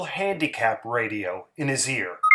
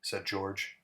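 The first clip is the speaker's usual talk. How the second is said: ordinary delivery, nothing marked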